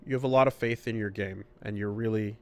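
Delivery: ordinary delivery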